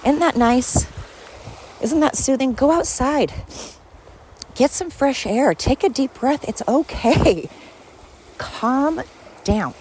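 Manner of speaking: high, sing-song spa voice, slow on it's okay; mock soothing. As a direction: lull them like a spa guide, a bit teasing. Calming viewers after water sounds.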